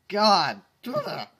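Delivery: loud, high cry of revulsion; disgusted outburst. Real, not acted. A reader reacting to the fanfic with a disgusted shout. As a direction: blurt it out in horror.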